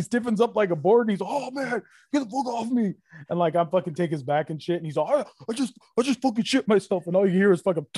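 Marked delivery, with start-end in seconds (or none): 1.3-2.9 panicked protest. Retold or imitated. Tackled shoplifter to the guard, panicked high protest. 5.1-7.1 imitated high-pitched, distressed blurting; mock a man's panicked, high-voiced admission; panicked confession. Tackled man confessing his accident, high mock panic.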